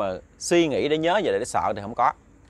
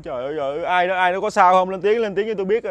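high-pitched, distressed calling out; retold tearful calling; 6 semitones higher, 3 dB louder than usual. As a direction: imitate a frantic searcher calling for a reply. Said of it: "ông vừa kiếm mà ông vừa khóc thành tiếng".

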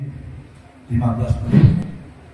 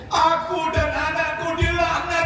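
high, slow chanted sermon shout; fervent sermon chant; preaching at full force. Acted. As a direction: chant it out to the congregation.

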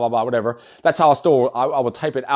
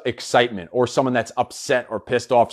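ordinary talk

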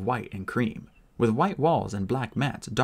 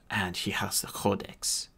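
whispered grave news; hushed whisper to the Irish warrior; 3 semitones lower, 6 dB quieter, slower than usual. Acted. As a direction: breathe it out as a secret. Flamel reveals grave news.

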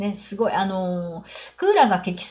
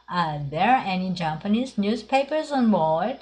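slow model reading; slower than usual. Shown as a demonstration. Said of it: "そして三つ目が".

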